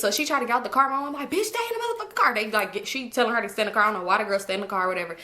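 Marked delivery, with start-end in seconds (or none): 1.3-2.3 much higher, fast mock yell of an angry mom. Mom ordering the other girl to stay put, fast high mock yell. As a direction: snap the order out quickly, high.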